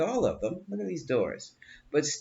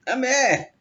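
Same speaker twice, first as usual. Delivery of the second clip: laughing; 9 semitones higher, 6 dB louder than usual. Real, not acted. Laughing at the [name] joke.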